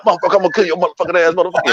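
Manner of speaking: high, fast hammed-up threat; mock furious rant. Comedian mocking himself going off on a heckler, hammed-up fast rant. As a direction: rattle off a fake rage at a heckler. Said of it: "I can't be like".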